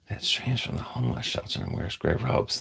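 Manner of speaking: whispered under the breath; whispered rereading of a chat line. Real, not acted. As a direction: breathe it out voiceless, to yourself.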